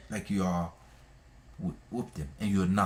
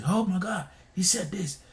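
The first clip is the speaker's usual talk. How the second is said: mock gossip gasp; 8 semitones higher than usual. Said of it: "You want people to say".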